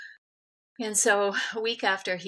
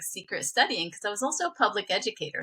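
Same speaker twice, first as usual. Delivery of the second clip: normal talking voice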